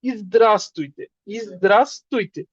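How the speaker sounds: slow, drawn-out "ইজদ্রাস্তুইতে" twice; slow model greeting "ইজদ্রাস্তুইতে"; pronunciation demo. Shown as a demonstration. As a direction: enunciate "ইজদ্রাস্তুইতে" slowly for the class. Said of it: "কি বলতে পারেন?".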